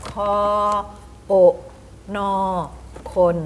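คอ โอะ นอ คน sounded out separately with long pauses; spelling aloud. Shown as a demonstration. Teacher showing how to spell-read, คอ โอะ นอ คน piece by piece. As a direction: sound out คอ, โอะ, นอ one at a time, then blend into คน. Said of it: "ถ้าอ่านสะกดคำ เราต้องออกเสียง".

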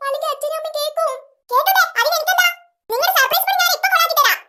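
ordinary talk

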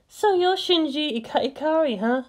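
playful high female voice; Misato. A narrator's reading. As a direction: play a teasing young woman.

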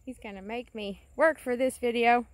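ordinary talk

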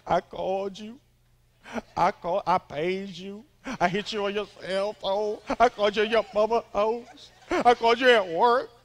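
building from plain to loud complaining; imitating a clingy girlfriend, rising to a loud whine; mock needy girlfriend. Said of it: "Don't you ever say again".

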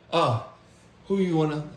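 ordinary talk, nothing marked

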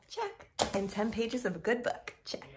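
ordinary delivery

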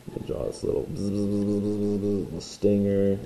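soft buzzy bzz sounds under the breath; bee buzzing. Pretend. Teacher drawing a bee for students, quiet buzzing. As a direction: buzz quietly like a little bee.

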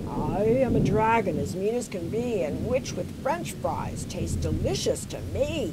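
loud menacing dragon voice; roaring dragon. A narrator's reading. Dragon threatening to eat the witch, loud menacing roar. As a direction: growl it out like a hungry dragon. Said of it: "heard a roar".

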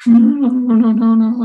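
half-acted tearful no, no, no; retold sobbing prayer. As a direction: imitate a woman crying out through tears.

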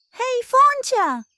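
a slow, high call across the rocks; older seal hailing the dreamer from afar. Acted. Older seal calls out to Foncia.

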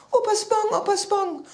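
high, loud urgent calls; frantic calling. A narrator's reading. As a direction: call out desperately to wake someone. Child tries to rouse grandpa. (panisch und laut rufen)

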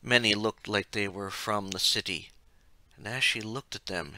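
ordinary delivery, nothing marked

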